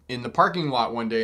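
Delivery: ordinary delivery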